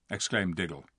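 usual speaking voice

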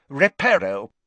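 firmly pronounced spell. A narrator's reading. Ogden mending the pot with a spell, firm. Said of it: "said firmly".

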